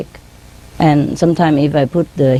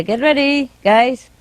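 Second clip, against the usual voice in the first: higher, sing-out call to the flock; calling out to birds; 7 semitones higher, slower than usual. Retold or imitated. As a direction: call them to get ready, pitched up. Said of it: "I say".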